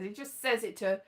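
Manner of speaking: normal talking voice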